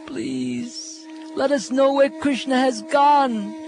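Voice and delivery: pleading gopis. Acted. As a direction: beg every tree as if your beloved is lost. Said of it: "Speaking as the gopis, he said".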